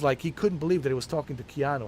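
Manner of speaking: normal talking voice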